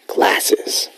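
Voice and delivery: a single whispered word. Real, not acted. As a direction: whisper it playfully.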